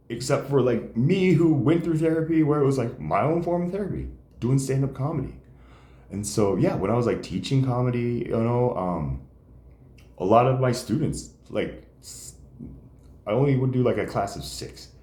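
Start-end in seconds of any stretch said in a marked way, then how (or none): none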